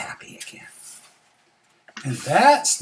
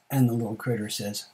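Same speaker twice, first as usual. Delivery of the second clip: ordinary delivery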